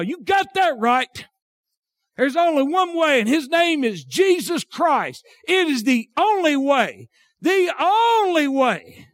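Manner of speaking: high, ringing preacher's declaration; emphatic pulpit cry; impassioned preaching. Real, not acted. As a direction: proclaim it with drawn-out key words. Preaches with rising force.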